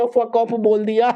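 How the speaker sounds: slow, drawn-out amused remark; amused mock. Hero teases that she swore, slow amused. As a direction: savour it teasingly. Hero mocks girl's curse.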